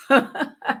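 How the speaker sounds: short burst of laughter; amused laugh. Real, not acted. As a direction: laugh it off briefly. Amused, no winner today.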